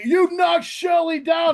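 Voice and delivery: high, loud, slow accusing yell; mock accusing shout. Host mimicking angry fans, high and loud. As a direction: shout it like an outraged fan. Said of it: "It's like".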